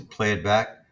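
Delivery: ordinary delivery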